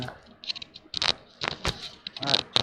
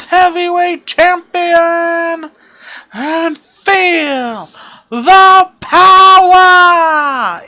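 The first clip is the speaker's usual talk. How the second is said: high, slow, booming hype; wrestling promo shout; 9 semitones higher, 10 dB louder, much slower than usual. Retold or imitated. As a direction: roar it to the arena.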